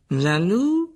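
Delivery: loud, high drawn-out mocking question; scornful echo. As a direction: throw the word back, rising in mockery. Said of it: "dit Malfoy". (reprise moqueuse, montante et forte)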